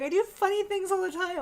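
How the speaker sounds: put-on high indignant kid voice; mimicking daughter. Mother mimics daughter insisting she is funny, high and indignant. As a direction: play the offended little girl.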